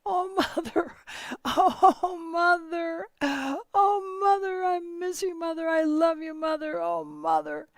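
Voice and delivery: high, loud keening sob for his dead mother; rocking and weeping, calling to his mother; wailing for mother. Acted. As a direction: cry out to her in anguish, voice breaking. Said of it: "crying and crying and saying".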